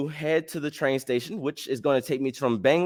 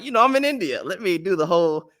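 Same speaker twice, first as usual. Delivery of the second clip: high, sing-out playful voice; playful acted thought; 6 semitones higher, 4 dB louder than usual. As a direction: pitch it high, hamming up the idea. Acted thought while flirting.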